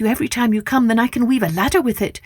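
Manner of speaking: put-on high, fast girl's voice; eager young girl. Rapunzel answers the prince's proposal, high and eager. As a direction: gush it out, eager to escape. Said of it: "she agreed at once".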